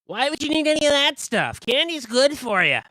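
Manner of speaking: high, loud puzzled merchant voice; high pushy seller; candy seller. Acted. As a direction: push candy on the customer.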